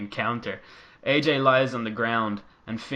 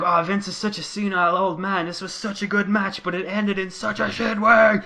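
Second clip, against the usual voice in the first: imitating whiny fans; 8 semitones higher, 5 dB louder than usual. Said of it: "everyone's like".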